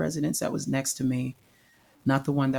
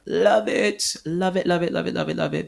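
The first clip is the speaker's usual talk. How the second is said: high, loud burst of joy that eases off; overflowing delight, repeated like a chant; gushing excitement; 4 semitones higher, 4 dB louder than usual. Real, not acted. Gushing about loving mentoring.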